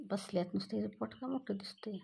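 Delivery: breathy whispered aside; hushed whispered worry. Real, not acted. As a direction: whisper it so the cat won't hear. Aside about the cat.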